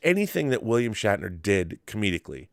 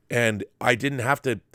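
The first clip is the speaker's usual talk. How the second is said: ordinary delivery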